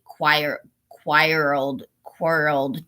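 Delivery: low, halting tries at "quirled"; stumbling pronunciation. Real, not acted. Reader struggling with a word in the book, stumbling pronunciation. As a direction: trip over "quirled", restarting it. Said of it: "I can't pronounce this word".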